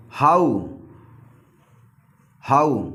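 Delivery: हाउ said as "haau", the ow rhyming with "cow"; हाउ with an open "aau" ending, not "o"; pronouncing how. Shown as a demonstration. Teacher models हाउ for the class. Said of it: "हाउ लिखा है ये".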